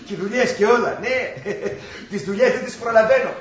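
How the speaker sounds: laughing reassurance. Acted. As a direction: chuckle through the good news. Laughing while reassuring mother on phone. (γελαστά, καθησυχαστικά)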